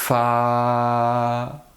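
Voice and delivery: "Fa" with a long f and long held a; sounded-out syllable. Shown as a demonstration. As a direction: stretch "Fa" slowly sound by sound. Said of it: "Qu'est-ce qu'il y a après f?".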